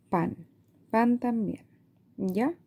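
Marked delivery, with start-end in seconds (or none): none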